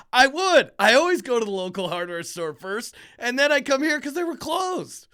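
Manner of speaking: replaying his frustration, voice up and loud; exasperated mock yell.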